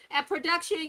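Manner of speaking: normal talking voice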